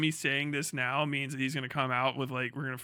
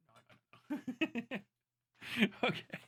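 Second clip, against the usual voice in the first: ordinary talk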